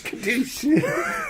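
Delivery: second boom shouted very high while laughing; shouted gun sound. Pretend. Imitating a shotgun blast.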